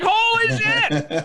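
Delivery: high, drawn-out amazed exclamation; mock amazement. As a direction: stretch it out in awed disbelief. Reacting to story's long-delayed payoff.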